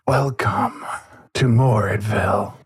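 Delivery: breathy voice. Acted. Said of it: "it says in a rather breathy voice".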